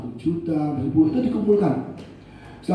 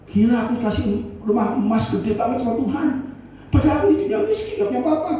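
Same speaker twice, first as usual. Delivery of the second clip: high, fast, astonished retelling; mock-stunned believer, higher and quicker; amazed; 7 semitones higher, faster than usual. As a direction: gush in disbelief at your own luck. Congregant amazed at heaven reward.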